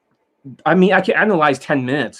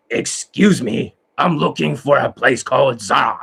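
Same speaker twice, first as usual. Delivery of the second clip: slow angry delivery. Shown as a demonstration. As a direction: drag it out, grim and menacing. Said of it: "when they slow down, they sound like this".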